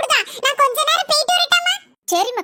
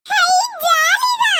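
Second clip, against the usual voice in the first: delighted; 8 semitones higher, much slower than usual. Acted. Child delighted at news.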